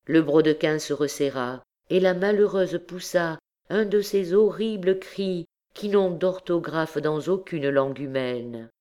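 ordinary delivery, nothing marked

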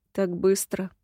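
low, hushed rustling spirit voice; soft rustling tree voice. A narrator's reading. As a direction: breathe it like leaves stirring. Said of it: "прошелестел рядом голос".

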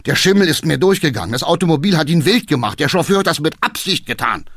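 fast, high, agitated self-defence; exclaimed excuse. A narrator's reading. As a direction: blurt out excuses in a rush. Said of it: "rief er aus". (hastig und aufgeregt rufend)

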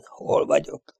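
soft, whispered question; faint murmur. A narrator's reading. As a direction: murmur it to yourself, barely voiced. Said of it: "halkan rebegte maga elé". (halk rebegés)

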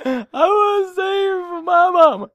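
very high, loud, sustained wail; hammed-up, screechy high holler; mock audition belting. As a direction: belt it out like an overwrought contestant. Mock audition, contestant bursts into performance.

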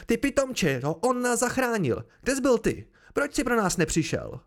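high, heated reproach; výkřik. A narrator's reading. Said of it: "vykřikl hostě". (vyčítavý zvýšený hlas)

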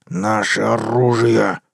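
slow, gritted, hissing order; slow gritted command. A narrator's reading. The wolf hisses at Sandy to bring the guns. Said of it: "с шипением процедил волк".

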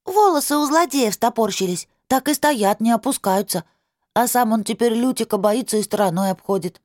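put-on high eager child voice; a small girl relishing the villain's fright; little girl voice. Girl continues her story.

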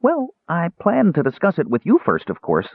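high kender voice, placating; butter them up in a high voice; high, ingratiating kender; flattering. A narrator's reading. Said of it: "hoping Raistlin was still subject to flattery".

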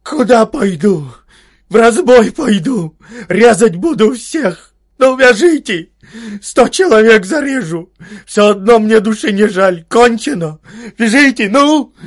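high, loud desperate hoarse shouting; a cornered young man shouting himself hoarse; hoarse yelling. Acted. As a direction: scream defiance with a raw voice. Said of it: "закричал сипло".

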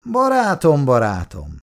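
loud, high exclamation of the first barátom; calling out. Acted. Lieutenant calling out to his friend, loudly. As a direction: call out barátom, barátom with glee. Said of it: "kiáltotta a hadnagy".

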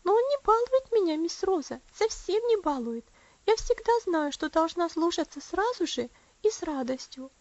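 put-on high, insistent little-girl voice; confiding child. A narrator's reading. Elsie confiding to Rosa about her strict papa, earnestly. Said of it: "она добавила доверительным тоном".

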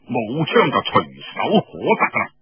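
usual speaking voice

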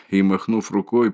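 usual speaking voice

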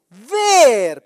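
loud, drawn-out single word; respuesta enfática. Real, not acted. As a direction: land the answer loudly and stretch it. Da la respuesta con énfasis. (enfático, fuerte y alargado)

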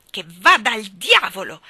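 loud defiant blurt; exploding outburst. A narrator's reading. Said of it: "esplose Davy".

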